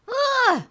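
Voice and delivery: very high, loud shriek of fright; startled yell. Acted. Trent sees the drawing move and yells in fright. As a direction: jump back screaming. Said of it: "yelled Trent".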